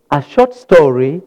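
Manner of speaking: usual speaking voice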